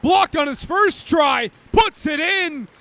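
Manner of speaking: shouted goal call; rapid hockey play-by-play. Real, not acted. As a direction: build to a roaring goal call.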